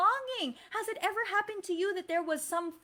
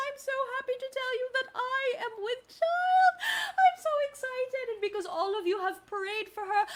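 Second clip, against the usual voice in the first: overjoyed mother; 6 semitones higher than usual. Acted. Mother telling the children she is pregnant, overjoyed and tearful. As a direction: burst out with happy news.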